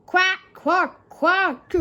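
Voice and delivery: loud, high mocking duck quacks. A narrator's reading. Engines jeering at Duck with loud, high quacks. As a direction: jeer at him like ducks. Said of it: "they wheezed".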